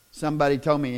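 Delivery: ordinary talk, nothing marked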